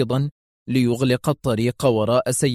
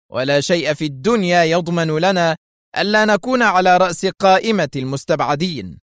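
sharp bitter; 6 semitones higher, 3 dB louder than usual. Acted. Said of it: "قبل أن يقول في حدة".

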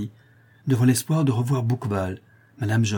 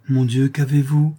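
worried cry; 4 semitones higher, 5 dB louder than usual. A narrator's reading. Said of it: "lui dit la goualeuse".

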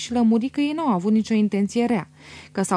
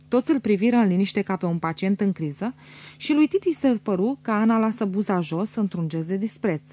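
plain reading voice

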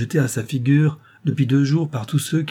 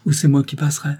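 a little louder, cheer put on over sadness; forced cheerfulness; 5 semitones higher, 5 dB louder than usual. A narrator's reading. Old servant to Rose, hiding tears with forced cheer. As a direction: brave joke she doesn't feel. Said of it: "sur un ton de gaieté forcée".

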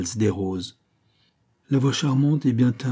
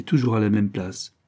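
usual speaking voice

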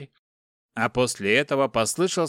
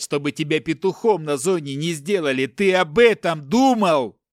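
high, loud angry tirade; furious father; 5 semitones higher, 5 dB louder than usual. A narrator's reading. Captive father blames his son, furious. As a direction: berate the son, building louder.

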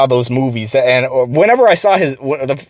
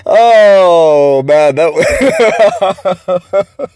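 high laughing fit spilling into words; breathless laughing, words stretched out; real laughter; 8 semitones higher, 4 dB louder, much slower than usual. Real, not acted. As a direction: crack up at your own story.

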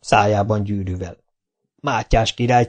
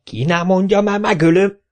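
higher, louder roar of "ki nem mondja"; mayor roaring at his lackeys; 9 semitones higher, 3 dB louder than usual. A narrator's reading. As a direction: bellow the threat. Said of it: "ordított a polgármester".

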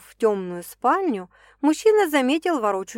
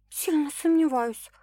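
grumbling whisper; 7 semitones higher than usual. Acted. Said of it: "буркнула девушка".

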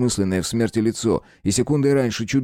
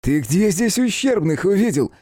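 high, angry snarl; furious high-pitched retort; growled challenge; 12 semitones higher, 3 dB louder than usual. A narrator's reading. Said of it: "прорычал Эллер".

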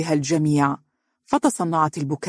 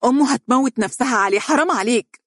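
higher, fast reproach; furious plea, high and quick; angry outcry; 8 semitones higher than usual. A narrator's reading. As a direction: cry out at him in anger. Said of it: "هتفت بغضب".

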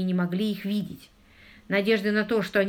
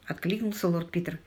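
ordinary delivery

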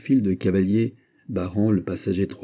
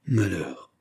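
dismayed exclamation. A narrator's reading. Fouquet learns of the king's order, sinking dismay. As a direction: let the word fall in dismay. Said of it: "s'écria Fouquet en se frappant le front".

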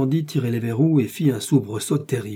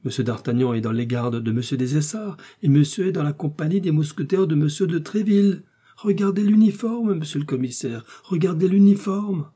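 agitated, pleading prisoner; insistent Bonacieux; 7 semitones higher, 5 dB louder than usual. A narrator's reading. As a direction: protest building to a high, louder appeal. Bonacieux argues with mounting urgency.

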